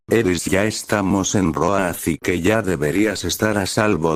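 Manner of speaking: usual speaking voice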